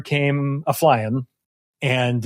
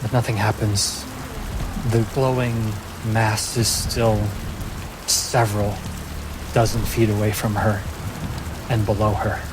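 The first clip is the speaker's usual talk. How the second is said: measured fantasy narration; story narration; 2 semitones lower than usual. A narrator's reading. Game master telling players what Poppy finds. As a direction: paint the storm scene for the table.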